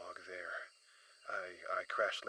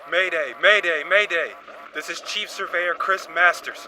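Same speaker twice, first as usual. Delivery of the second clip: loud distress call over a crackling radio, higher; shouted radio mayday; 10 semitones higher, 16 dB louder than usual. Acted. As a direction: yell the mayday into the comms over the noise. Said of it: "as the radio transmission said".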